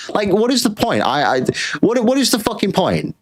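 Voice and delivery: exasperated. Real, not acted. Streamer rants at viewers over small tips, exasperated. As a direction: throw your hands up in disbelief.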